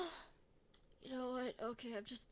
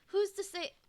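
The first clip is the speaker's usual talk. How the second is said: 1 dramatic rhetorical question; 9 semitones higher, 7 dB louder than usual. Real, not acted. Mock-heroic rhetorical question.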